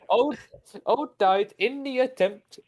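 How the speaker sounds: high, slow mock Russian drifting into Indian; accidental Indian accent. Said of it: "I'm going Indian now".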